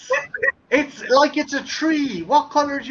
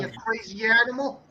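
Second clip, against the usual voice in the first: a light New York accent calling out "get off the"; a hint of a Boston–New York street voice. Shown as a demonstration. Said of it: "kinda New York and Boston kinda, dialect".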